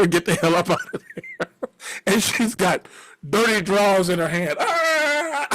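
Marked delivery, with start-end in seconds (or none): 4.6-5.5 long, high held "ah"; horrified cry. Retold or imitated. Woman holding dirty drawers, high horrified cry. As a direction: imitate a drawn-out cry of horror. Acting her horrified discovery.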